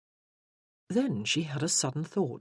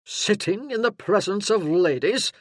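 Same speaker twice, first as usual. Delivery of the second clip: louder, parade-ground bark; a burly sergeant taking charge; booming soldier arriving in force; gruff sergeant; 5 semitones higher, 7 dB louder than usual. Acted. Sergeant rebukes Crawley.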